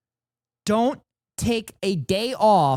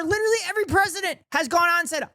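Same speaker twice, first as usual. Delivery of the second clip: pitched high, scoffing at how easy it is; high, fast disbelieving rant; exasperated rant; 11 semitones higher, much faster than usual. Real, not acted. Streamer scoffing at the debate clip, high exasperated rant.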